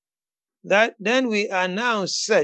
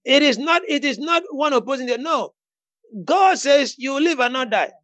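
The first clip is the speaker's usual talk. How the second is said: raised, emphatic preacher's declaration; fervent preaching; 5 semitones higher than usual. Real, not acted. Preacher urging a friend to declare life over Vanessa, fervent preaching. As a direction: preach it with bold authority, pitched up. Preaches against the devil's word.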